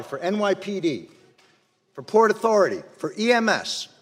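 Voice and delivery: ordinary talk, nothing marked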